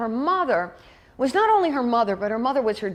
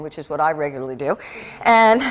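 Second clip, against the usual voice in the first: ordinary talk, nothing marked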